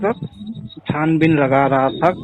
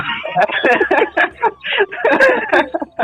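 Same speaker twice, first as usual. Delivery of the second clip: giggling burst; high laugh. Real, not acted. Laughing while retelling the sister's scolding. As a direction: let the laugh spill out.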